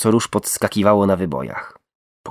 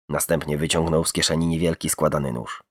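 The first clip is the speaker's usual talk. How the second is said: plain reading voice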